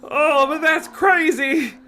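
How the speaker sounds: very high, loud, slow wail; crying out. Acted. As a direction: wail it in disbelief. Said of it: "Carly Beth cried".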